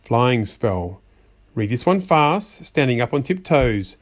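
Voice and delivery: plain reading voice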